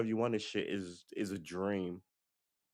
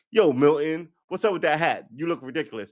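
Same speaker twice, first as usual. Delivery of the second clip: loud, higher ribbing call across the room; teasing coworker; 6 semitones higher, 8 dB louder than usual. Retold or imitated. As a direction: rib your buddy about his hat. Friend teasing at work.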